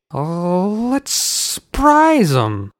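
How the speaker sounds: put-on high, slow drunken drawl; tipsy uncle. A narrator's reading. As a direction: slur it, merry and tipsy. Drunk uncle between sips of wine.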